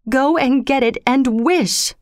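high, slow, urgent command; shaking with excitement. A narrator's reading. Wife sending her husband for the paw, excited cry. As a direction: draw out each word with rising excitement. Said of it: "shaking with excitement".

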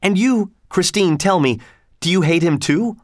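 high-pitched, mildly impassioned questioning; understated agitated demand; crying out. A narrator's reading. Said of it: "cried Raoul".

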